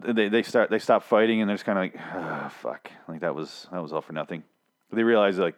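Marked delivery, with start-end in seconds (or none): 2.0-4.4 much softer and lower, sighed out; deflated mutter. Retold or imitated. Reviewer voicing two men after a pointless fight, deflated. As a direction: imitate someone quietly giving up.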